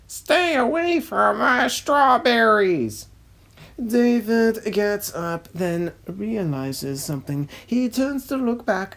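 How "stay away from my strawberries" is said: loud, higher shout from off screen; angry farmer yelling. Acted. Farmer John yells at the fleeing duck, loud angry shout. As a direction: bellow at the thieving duck to stay off his crop. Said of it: "Farmer John off screen".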